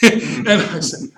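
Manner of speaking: laughing through the words; high laughing speech. Real, not acted. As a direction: laugh as you go on. Laughs while retelling.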